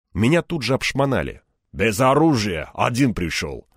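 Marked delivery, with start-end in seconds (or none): none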